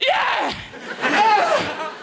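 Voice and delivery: high, loud mock cheer; pigeon family cheering. Pigeon wife and kids greet him, loud high cheer. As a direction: shout Yeah like excited kids. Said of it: "the wife and the kids going".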